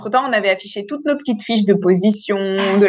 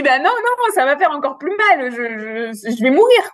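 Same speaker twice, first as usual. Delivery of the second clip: retold panic; 4 semitones higher, slower than usual. Panicked at the waters breaking.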